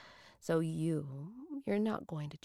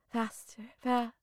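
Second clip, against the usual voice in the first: rising, louder urging; urgent and breathless; 5 semitones higher, 8 dB louder than usual. Acted. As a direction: push them faster, getting louder. Urging partner on, moaning.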